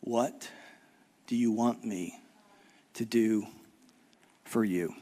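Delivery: soft, low, slow gentle question; gentle Jesus. Retold or imitated. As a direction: ask tenderly as if offering help. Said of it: "and said".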